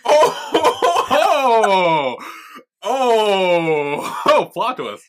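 laughing exclamation. Real, not acted. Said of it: "laughing reaction".

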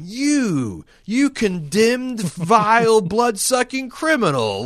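high, loud, drawn-out theatrical denunciation; mock disdain. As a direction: spit out each insult slowly, hamming up the contempt. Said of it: "disdain for Khan".

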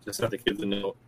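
choppy, garbled bad connection; radio breaking up. Real, not acted. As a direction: voice cutting in and out. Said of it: "You're breaking up real bad again".

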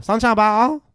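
hammed-up Chinese accent; exaggerated Chinese accent. Shown as a demonstration. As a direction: punch the name up in a Chinese accent.

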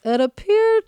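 a big gasp of disbelief before the reveal; dramatic build-up. Real, not acted. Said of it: "I can't believe I'm gonna say this".

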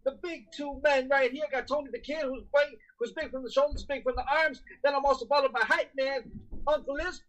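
high-energy hype intro; pumped-up announcer voice; energy drink ad. Acted.

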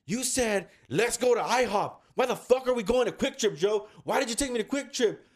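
high, exasperated imitation of a friend's rant; mock-furious accusing questions; retold angry blaming. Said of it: "This is your fucking fault".

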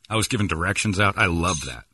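usual speaking voice

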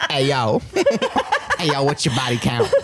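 laughing bro impression; mock Gen Z guy. Joking as a Gen Z guy on a date, laughing. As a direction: play the brash young dater, cracking up. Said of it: "one of these Gen Z guys".

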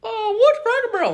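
very high, loud squeaky falsetto; hammed-up clueless children; mock kids' voice. Kids answer the camper about a restaurant, mimicked high falsetto.

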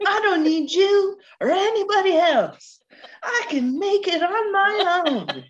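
quoting Joey's mock-dramatic tantrum; defiant outburst. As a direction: hurl it like a stormy exit line. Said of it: "You'll see".

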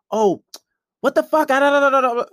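high, loud mock outburst; angry, loud, upset. Girlfriend coming at him angry, mock loud and upset. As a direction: rant that tumbles into loud nonsense syllables. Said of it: "getting angry and, and, and, yeah, loud and upset".

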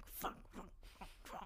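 hushed whispered swearing; whispered cursing. Retold or imitated. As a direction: whisper it under your breath. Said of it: "in the teacher area, like".

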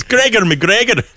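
"Gregor McGregor" drawn out slowly, high and loud in a hammed-up Scottish accent; terrible Scottish accent. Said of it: "so will this terrible accent return".

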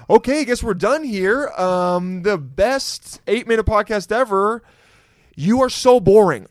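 high, loud, hammed-up sign-off; mock dismissive host. Host abruptly ending a podcast with a boring guest, mock dismissal. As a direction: cut the guest off with exaggerated scorn.

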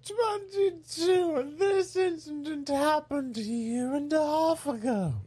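put-on high, slow, quavering old lady; frail old woman who thinks she's young. Shown as a demonstration.

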